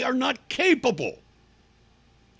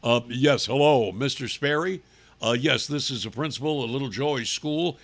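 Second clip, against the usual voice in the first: mock phone voice principal; 7 semitones lower than usual. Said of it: "he gets on the phone".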